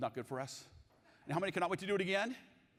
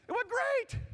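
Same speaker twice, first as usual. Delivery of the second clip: salesman; 6 dB louder, slower than usual. Retold or imitated. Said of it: "And Jeff says".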